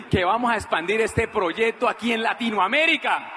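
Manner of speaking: loud, hammed-up grand claim; laughing boast. César bluffs to his brother about expanding, laughing boast. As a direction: belt it out, tickled by the bluff.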